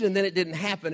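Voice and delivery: ordinary delivery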